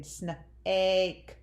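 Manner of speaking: SNAKE split after "sn", A drawn out; long A. Shown as a demonstration. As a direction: slowly sound out snake, holding the A. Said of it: "Or long A, like in".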